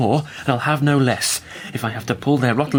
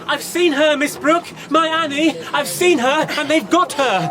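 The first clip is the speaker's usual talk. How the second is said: agitated report; 14 semitones higher than usual. Acted. Distraught father reporting daughter.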